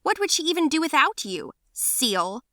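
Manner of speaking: high, quick sneering jab; mocking taunt. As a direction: tease her cruelly. Mocking girl taunts narrator.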